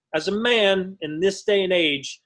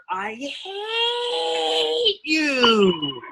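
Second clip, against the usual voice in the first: higher, very slow drawn-out yell; higher, drawn-out mock scream; retold teen yell; 9 semitones higher, much slower than usual. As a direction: wail it like a furious teenager. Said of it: "my teenage daughter yelling at her mother".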